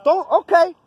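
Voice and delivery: man arguing with an officer, angry snap; angry outburst. Real, not acted. Heated exchange with officer.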